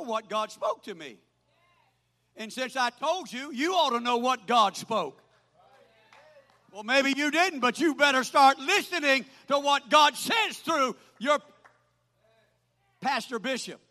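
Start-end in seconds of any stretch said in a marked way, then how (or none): 2.4-13.8 loud, insistent pulpit exhortation; forceful preaching. Real, not acted. Preacher warning the congregation to listen, forceful preaching. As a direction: press every phrase on the listeners, loud.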